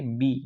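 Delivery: बी said as "bee", the letter's name. Shown as a demonstration. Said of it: "अकेले में क्या कहलाता है". (अक्षर का नाम "बी")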